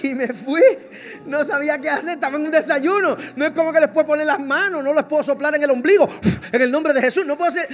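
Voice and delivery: loud, high, laughing panic; flustered panic. Retold or imitated. Preacher retelling his panic at the breakfast table, loud and laughing. Flustered pastor fleeing crying couple.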